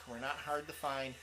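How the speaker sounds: ordinary delivery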